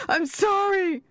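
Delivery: screamed apology. Acted. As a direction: scream it at the top of the voice. Buried man begs forgiveness.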